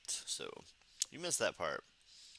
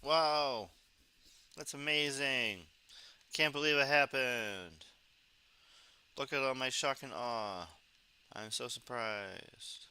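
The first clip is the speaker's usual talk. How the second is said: sarcastic amazement; 2 semitones higher, slower than usual. Pretend. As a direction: feign shock, slowly stretching each phrase. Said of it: "that was sarcasm".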